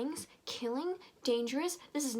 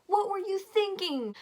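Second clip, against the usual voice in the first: scolding shout; 10 semitones higher, 8 dB louder than usual. Acted. Jessie scolding the narrator.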